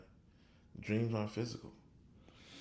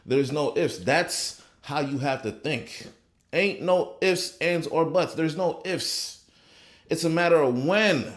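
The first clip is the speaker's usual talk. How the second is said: louder, higher, slow emphatic preaching; rising preacher's fervor; fired-up preaching; 5 semitones higher, 5 dB louder than usual. Acted. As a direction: hammer the point home to the congregation. Preaching at full force.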